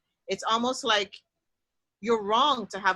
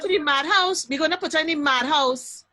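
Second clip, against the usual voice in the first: put-on higher, louder broad Trinidadian accent; 6 semitones higher, 5 dB louder than usual. Family announcing she's sent away, in loud Trinidadian accent. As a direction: declare it in full Trini dialect. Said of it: "my Trinidadian accent".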